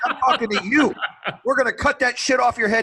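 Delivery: high, loud threat to a recruit; yelling petty officer. Retold or imitated. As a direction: imitate a drill instructor's yell. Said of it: "And he's yelling at me".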